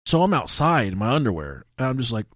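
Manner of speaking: normal talking voice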